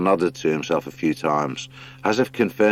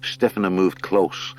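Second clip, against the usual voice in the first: ordinary delivery, nothing marked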